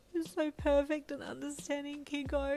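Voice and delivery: high, tearful-sounding wail; bawling, pitched right up. A narrator's reading. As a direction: wail it, overcome with emotion. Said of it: "you wailed".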